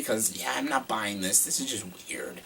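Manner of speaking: hushed aside whisper. Real, not acted. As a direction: whisper it as a confession. Awkward scene comment.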